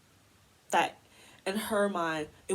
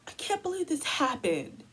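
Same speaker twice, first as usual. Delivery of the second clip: gasp, then high mock dismay; mock shocked disbelief; 6 semitones higher than usual. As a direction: imitate her feigned shock, pitched up. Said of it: "you're just like".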